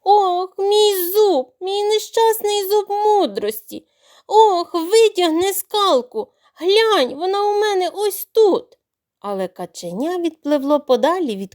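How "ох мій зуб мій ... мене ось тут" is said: much higher, loud, slow wailing yell; yelling at the top of lungs. Acted. Crocodile yelling to drown out the brothers' voices. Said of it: "закричав на все горло".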